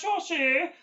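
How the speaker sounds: quick mock North Welsh lilt; North Wales accent. Teacher imitating people from North Wales, in a high mock accent. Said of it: "they're sounding like this".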